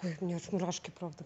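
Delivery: normal talking voice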